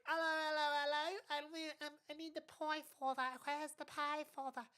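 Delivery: high, hammed-up posh British voice; silly overdone British accent demanding pie crumbs; exaggerated British accent. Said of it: "if someone was like".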